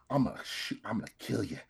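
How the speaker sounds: breathless whispered death threat. Retold or imitated. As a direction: hiss the threat under your breath. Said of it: "He whispers and he's like".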